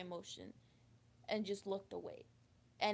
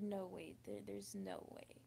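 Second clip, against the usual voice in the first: tearful whisper; 2 semitones lower, 7 dB quieter than usual. Real, not acted. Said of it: "I think I'm gonna cry".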